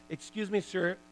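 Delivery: mock polite. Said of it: "something came out of my mouth that I couldn't believe".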